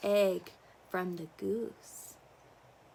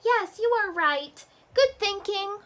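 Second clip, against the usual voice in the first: duck voice; 12 semitones higher, 6 dB louder than usual. Acted. Duck's high voice.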